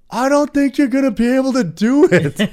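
loud, very high jeering voice; mock heckler. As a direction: call out the doubt in a mocking falsetto. Imitating a doubting heckler.

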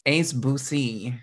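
Boothie drawn out slowly; playful mispronunciation. Pretend. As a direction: stretch Boothie out in a teasing mispronunciation. Playful mispronunciation of brand name.

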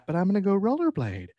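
lightly put-on high, carefree kid; mock teen boy. Teased friend as a teen announces rollerblading to mom, mock carefree. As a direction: imitate a kid airily announcing plans. Imagined teen in midriff shirt.

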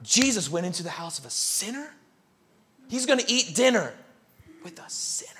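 scandalized gossip. Retold or imitated. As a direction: gossip in disbelief behind his back. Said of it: "religious people are outside going".

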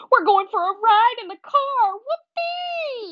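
high, squealing excitement; thrilled high-pitched cheer; overjoyed dog. Acted. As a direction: squeal with glee, ending in a long whoop. Dog thrilled by car ride.

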